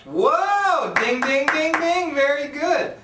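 high, loud game-show ding; excited cheer. Real, not acted. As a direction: celebrate like a quiz host. Correct guess, game-show cheer.

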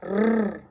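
"Р" as a sustained trill; trilled "Р" held alone; isolated sound. Shown as a demonstration. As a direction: roll a long "Р" with no vowel. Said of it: "Далі йде звук".

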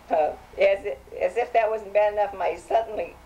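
ordinary delivery

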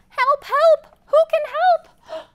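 high, loud little duck crying help; duck calling for help. Acted. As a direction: squeak out a panicky call for help.

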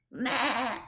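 bleating like a lamb; sheep bleat. Shown as a demonstration. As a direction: give a little lamb's bleat. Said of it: "What does it mean to bleat?".

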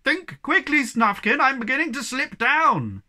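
high, loud panicked shout; frantic shout. A narrator's reading. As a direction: shout down while slipping. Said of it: "the Hemulin shouted".

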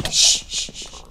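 unvoiced hush to stay hidden; shushing whisper. Real, not acted. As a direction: whisper the shh to keep quiet. Hiding with TNT, shushing.

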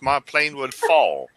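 ordinary talk

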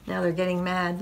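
usual speaking voice

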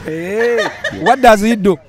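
usual speaking voice

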